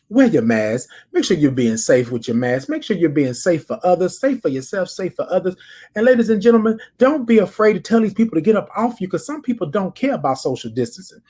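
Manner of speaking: ordinary talk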